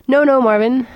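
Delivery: a lilting, cheerful reassurance to the robot; lilted. A narrator's reading. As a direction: sing it out brightly and loudly. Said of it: "lilted Trillian".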